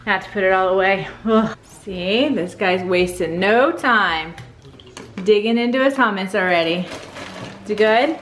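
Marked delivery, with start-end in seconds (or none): none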